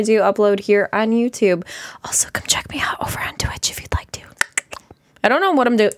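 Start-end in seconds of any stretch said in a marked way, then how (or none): 2.0-4.2 unvoiced whisper, a little faster. Real, not acted. Plugging her Twitch to viewers in a whisper. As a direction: slip the plug in a hushed whisper. Channel plug aside.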